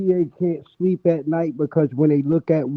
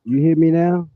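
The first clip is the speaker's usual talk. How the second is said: muffled; 4 dB louder than usual. Real, not acted. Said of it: "you're still muffled".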